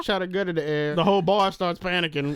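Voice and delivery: ordinary talk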